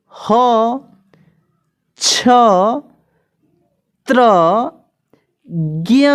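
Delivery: each letter called out alone after a long pause; alphabet recitation. Shown as a demonstration. Teacher reading the consonant chart aloud to the class. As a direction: call the letters one by one like a classroom drill.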